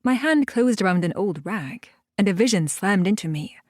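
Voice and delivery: ordinary delivery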